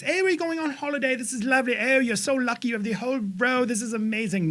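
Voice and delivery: playing the attendant in a cheery Mary Poppins accent. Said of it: "Now I sound like Mary fucking Poppins".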